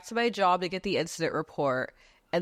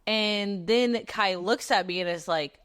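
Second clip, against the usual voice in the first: ordinary talk, nothing marked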